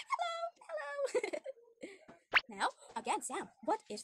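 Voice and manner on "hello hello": much higher, squeaky hello; high cartoon hello; cute fish voice. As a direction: greet the child like a tiny cartoon fish. Teacher greets fish in voice.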